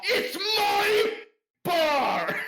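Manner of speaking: very high, loud screech; full-volume, high-pitched indignant yell; outraged shout. Acted. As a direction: screech it as an angry claim. Couple quarrel over the bar.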